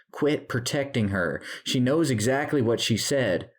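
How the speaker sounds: high, heated accusation; angry confrontation. A narrator's reading. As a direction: lash out at the one protecting her. Tyler angrily confronts Maggie and Anna.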